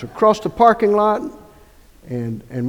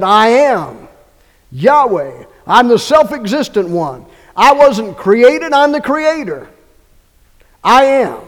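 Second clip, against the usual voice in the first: louder, higher, slow proclamation as God; voicing God; 4 semitones higher, 7 dB louder, slower than usual. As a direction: declare it as God, each phrase ringing. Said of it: "And he said".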